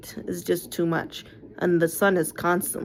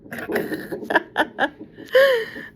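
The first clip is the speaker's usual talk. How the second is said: high burst of laughter. Real, not acted. Laughs at husband's remark.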